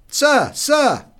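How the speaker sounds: high, loud call from a distance. Acted. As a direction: hail him from far above. Waiter calls from the jetty.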